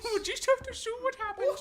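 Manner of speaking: high voice breaking into laughs; laughing mock whine. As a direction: laugh through a fake whimper.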